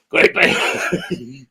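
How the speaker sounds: a dropped, soft put-on voice; put-on voice, low and quiet. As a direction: mutter it low like a character. Messing with voices on video.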